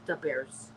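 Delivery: "da bears" with "da" for "the", low and soft; "da" replacing "the" before "bears"; Chicago "da". Shown as a demonstration. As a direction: say "da bears" quietly with a hard d. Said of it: "D A bears".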